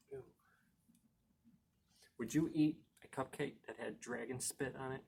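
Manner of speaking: usual speaking voice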